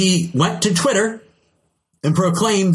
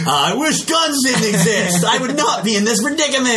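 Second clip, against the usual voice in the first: mock shot victim; 7 semitones higher than usual. Voicing a shot person.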